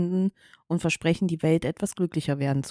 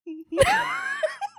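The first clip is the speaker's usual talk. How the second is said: high shrieking laugh. Real, not acted. Loud laugh fit.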